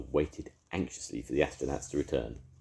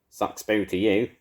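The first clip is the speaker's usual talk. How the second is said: taunt; 6 semitones higher, 7 dB louder than usual. A narrator's reading. Edna taunts Mavis.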